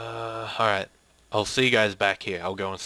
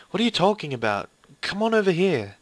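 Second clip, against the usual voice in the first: higher, louder teacher's call; calling over; 7 semitones higher, 4 dB louder than usual. Acted. Teacher calls frightened student over, raised higher voice. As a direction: beckon someone with a raised voice.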